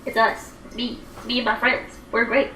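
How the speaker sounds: ordinary delivery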